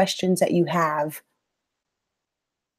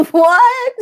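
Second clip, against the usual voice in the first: laughing; 11 semitones higher, 5 dB louder than usual. Real, not acted. Laughs at a surprising chat message.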